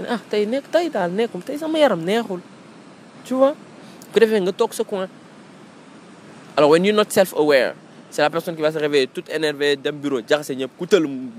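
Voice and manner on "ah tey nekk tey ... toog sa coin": much higher, put-on voice of someone in a bad mood, sulking; moody grumpy reply. Grumpy person brushing off coworkers' questions, high sulky voice. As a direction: whine that today you're not feeling well.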